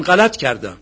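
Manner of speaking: loud retold admission of fault; shouted confession. Benefactor admitting his mistake before the gathering, retold shout. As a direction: call it out loudly before everyone. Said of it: "در حضور جمع داد زد".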